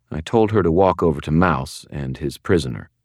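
ordinary delivery, nothing marked

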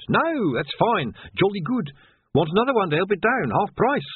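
high, eager relief; relieved gush. Acted. Relieved pieman offering another pie, high and eager. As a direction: babble with relief.

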